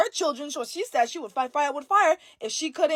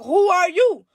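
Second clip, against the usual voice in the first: high, loud, commanding demand; demanding shout; 6 semitones higher, 5 dB louder than usual. Retold or imitated. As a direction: shout down the spirit. Mother confronts the spirit.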